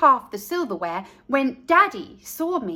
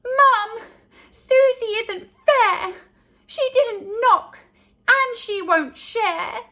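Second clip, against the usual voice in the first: put-on high, loud little-girl whine; high-pitched tattling child; whiny complaint; 9 semitones higher, 4 dB louder than usual. A narrator's reading. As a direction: complain to mum like a wronged toddler. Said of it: "I cried".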